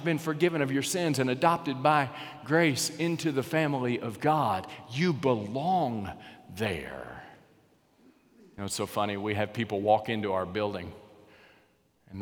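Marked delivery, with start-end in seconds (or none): 4.9-7.2 very slow, dropping soft and low; tender assurance. Real, not acted. Preacher telling each listener they belong, slow and soft. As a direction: lay it down gently like a promise.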